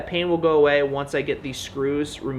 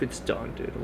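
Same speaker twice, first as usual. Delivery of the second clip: usual speaking voice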